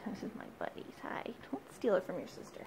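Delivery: fast whisper to the pet. Real, not acted. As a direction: whisper it quickly. Quiet aside to bird.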